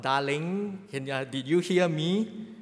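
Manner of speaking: ordinary delivery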